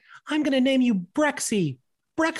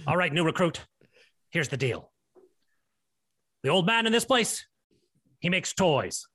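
very low, grim army man; drill sergeant; 7 semitones lower than usual. Acted. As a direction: tell the dark tale like a sergeant. Army man tells grim tale.

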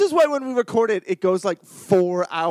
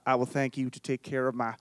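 put-on Westworld-style accent; 9 semitones lower, 7 dB quieter than usual. Actress retelling her botched audition, low Westworld accent. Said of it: "talking like I was on Westworld".

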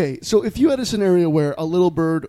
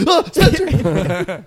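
hammed-up fright, long drawn-out cry; drawn-out mock yelp of surprise; mock startled yelp; 8 semitones higher, much slower than usual. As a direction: play a startled friend crying out his name. Tim startled by bird Spencer.

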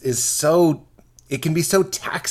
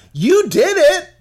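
exasperated ranting; 11 semitones higher, 4 dB louder than usual. Real, not acted. Mocking pastors, raised voice.

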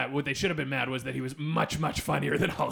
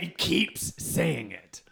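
ordinary talk

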